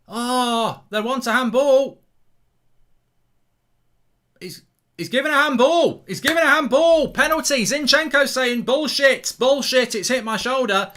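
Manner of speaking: high, loud burst of live commentary; excited shout. Real, not acted. Watchalong host calling a handball penalty, excited shouting. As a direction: shout the penalty drama as it unfolds.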